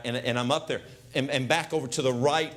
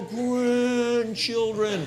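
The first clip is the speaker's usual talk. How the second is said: put-on grandpa's slow-motion movie cry to the kids; slow-motion call; 7 semitones higher, much slower than usual. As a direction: stretch it like a film reunion. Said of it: "I go".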